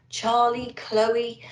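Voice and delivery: usual speaking voice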